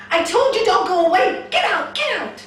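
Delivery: high, full-voiced furious yell; angry shout. Man at his door shouting at a stray dog, furious. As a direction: yell to drive the dog away. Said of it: "you shouted at me".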